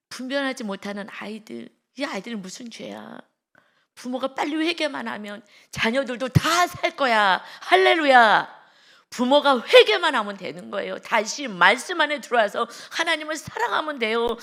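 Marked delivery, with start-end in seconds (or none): 3.9-14.4 loud, rising sermon cry; fervent preaching. Real, not acted. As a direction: call the parents to repent at full force. Preaching at full force. (부모들에게 회개를 외치는 뜨거운 설교)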